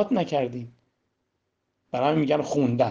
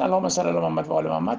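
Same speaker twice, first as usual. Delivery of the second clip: low, fast, perfunctory rattle; careless rote recitation; 9 semitones lower, faster than usual. Shown as a demonstration. Preacher shows listeners an empty salawat, careless and hurried. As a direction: rattle the blessing off as if it meant nothing. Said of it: "حالا الکی بفرستین".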